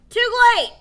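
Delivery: very high, loud triumph; Billy shouting down his victory; gleeful yell. Acted. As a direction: crow it out, mouth full. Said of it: "Billy yelled, still chewing".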